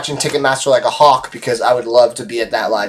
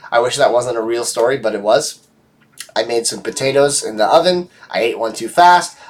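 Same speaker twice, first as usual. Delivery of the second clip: normal talking voice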